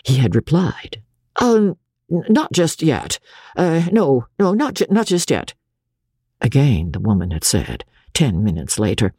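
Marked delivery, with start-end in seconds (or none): 1.4-5.6 put-on high, stammering young doctor; hesitant bluff to hide ignorance; bewildered, unsure. A narrator's reading. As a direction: stall nervously, tripping over the words. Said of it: "Bewildered and not daring to show his ignorance".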